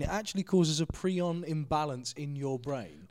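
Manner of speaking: ordinary talk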